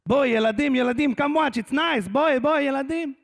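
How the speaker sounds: put-on high, eager calling to children; excited Israeli guy. Israeli dad calling children to watch, excited. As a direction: call the kids over in mock-Israeli delight. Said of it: "some Israeli guy going".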